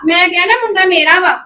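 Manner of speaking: loud, stubborn repetition; angry insistence. Acted. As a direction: hammer home that the boy is hers. Custody quarrel over the boy. (ਜ਼ਿੱਦੀ ਗੁੱਸੇ ਵਾਲੀ ਉੱਚੀ ਆਵਾਜ਼)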